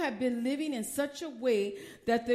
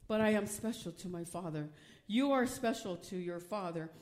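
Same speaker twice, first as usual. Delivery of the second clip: lower, softer, sincere; tender reassurance; 6 semitones lower, 5 dB quieter than usual. Real, not acted. Preacher reassuring the church that they are special to the Father. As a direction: say it gently to each listener.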